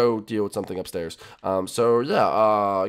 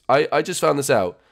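ordinary talk, nothing marked

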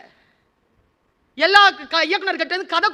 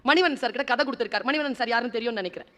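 ordinary delivery

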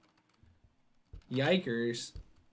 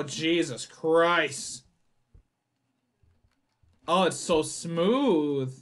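ordinary delivery

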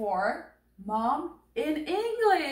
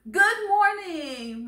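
slow, high sing-out, louder; cheerful morning greeting; 7 semitones higher, 5 dB louder, slower than usual. Acted. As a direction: greet your parents in a drawn-out singsong. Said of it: "what do you say to mommy and daddy".

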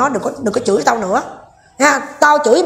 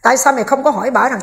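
ordinary talk

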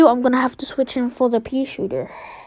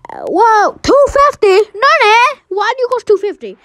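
high, loud disbelief; shocked outburst; 10 semitones higher, 5 dB louder than usual. Real, not acted. Player sees the plant's price, yelping in shock. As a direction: yelp it in high-pitched astonishment.

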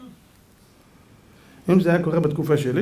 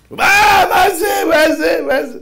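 high, loud shocked yell; father yelling in shock; father's shout; 13 semitones higher, 7 dB louder, slower than usual. Retold or imitated. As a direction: burst out shouting in alarm. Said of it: "אבא אחד התחיל לצעוק".

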